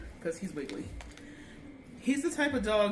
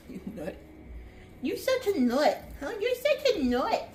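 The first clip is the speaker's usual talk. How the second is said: gushing pet talk; 9 semitones higher, 4 dB louder than usual. Real, not acted. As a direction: squeal it fondly at a silly dog, drawn out. Teasing the dog affectionately.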